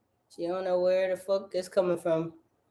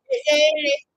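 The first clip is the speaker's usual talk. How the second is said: the line pitched way up high and loud; high take; 6 dB louder, faster than usual. Shown as a demonstration. Rehearsing a lyric take, pitched way up. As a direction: squeak it out high.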